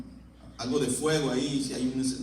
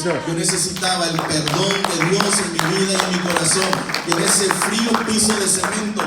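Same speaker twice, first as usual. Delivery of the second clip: louder, pleading prayer retold from the pulpit; impassioned testimony; 5 semitones higher, 4 dB louder than usual. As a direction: beg the Lord, voice lifted. Retells his tearful conversion prayer. (testimonio suplicante y fuerte)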